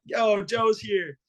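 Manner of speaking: high, loud, drawn-out announcement; excited call to Dad. Retold or imitated. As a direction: holler the big news to your dad. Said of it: "like, calling him".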